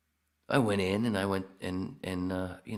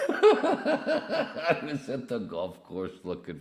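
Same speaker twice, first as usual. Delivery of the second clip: laughing; 5 semitones higher, 5 dB louder, slower than usual. Real, not acted. Laughing while reading joke.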